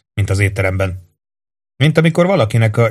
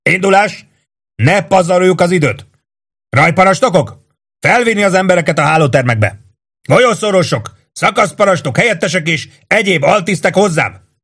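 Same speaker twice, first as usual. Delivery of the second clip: barked commands; 9 semitones higher than usual. A narrator's reading. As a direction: drive the men up the stairs. Warden barks commands.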